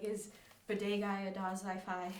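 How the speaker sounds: muddle "bidet guy a Dazai fi" as if too sleepy; lightly slurred "bidet guy a Dazai fi", words swapped and run together; imitating slurred speech. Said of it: "she kept slurring it at certain points".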